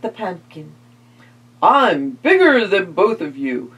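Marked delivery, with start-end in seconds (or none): 1.6-3.7 proud, puffed-up vampire voice; boasting vampire. Acted. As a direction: brag like a big vampire. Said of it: "boasted the vampire".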